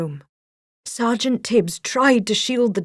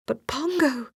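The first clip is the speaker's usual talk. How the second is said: worried; 8 semitones higher than usual. A narrator's reading. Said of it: "Perdita pointed out".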